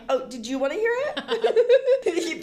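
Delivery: high, loud, drawn-out imitation; exaggerated high-pitched asking; mock eager listeners. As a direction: mimic a keen stranger, laughing. Imitates eager strangers, laughing.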